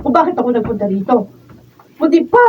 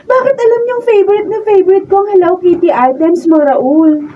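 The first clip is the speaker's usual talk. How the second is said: giggling delight; 7 semitones higher, 5 dB louder than usual. Acted. Girl delighted, laughing.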